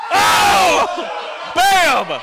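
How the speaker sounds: high, loud, real scream of delight. Real, not acted. Commentator screaming as a shot holes out. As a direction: shriek at the shot. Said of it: "I'm sorry I'm screaming".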